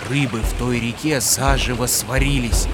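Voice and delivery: ordinary delivery, nothing marked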